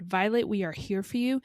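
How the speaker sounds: ordinary delivery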